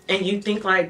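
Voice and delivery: usual speaking voice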